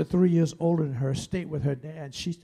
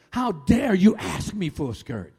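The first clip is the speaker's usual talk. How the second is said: loud, raised-pitch scolding tirade; angry yelling; 5 semitones higher, 6 dB louder than usual. Retold or imitated. Dad yells at daughter in the store, angry rebuke. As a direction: imitate a father lashing out. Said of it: "turned around and yelled at her".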